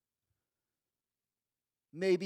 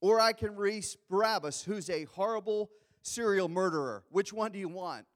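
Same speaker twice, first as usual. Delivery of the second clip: loud offer to crowd; 5 semitones higher, 8 dB louder than usual. Retold or imitated. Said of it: "he goes out and tells the crowd".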